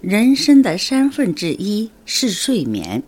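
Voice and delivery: slow, measured Mandarin model sentence; model Mandarin pronunciation. Shown as a demonstration. Teacher models the week's phrase for learners, slow careful Mandarin. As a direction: say 人生的三分之一是睡眠 slowly, each tone fully drawn out. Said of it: "中国語ではどういうふうに言えばいいでしょうか".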